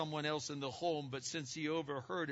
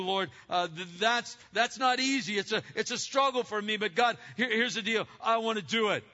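louder and higher, pleading in mock prayer; struggling believer praying; 6 semitones higher, 7 dB louder than usual. Believer wrestling in prayer before the Lord, pleading. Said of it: "voicing a struggling believer".

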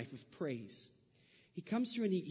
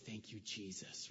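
relieved hush; 8 semitones lower, 12 dB quieter, slower than usual. Retold or imitated. Relief after being let go.

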